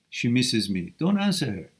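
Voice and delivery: ordinary talk, nothing marked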